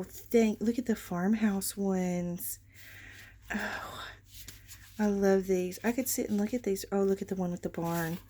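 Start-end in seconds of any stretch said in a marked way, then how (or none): 3.5-4.0 tearful sigh. Real, not acted. Moved by scripture cards.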